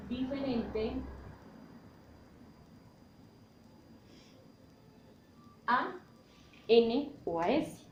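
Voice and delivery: drawn-out words split by long pauses; slow dictation. Real, not acted. Teacher giving students the rule for when tilde is marked, slow dictation. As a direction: dictate piece by piece, leaving time to write.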